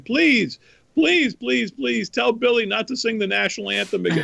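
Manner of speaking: high-pitched begging, hammed up; imitated high, urgent cry; mock screamed plea. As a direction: plead like a desperate crowd. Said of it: "they're screaming".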